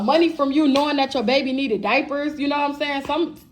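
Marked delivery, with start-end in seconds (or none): none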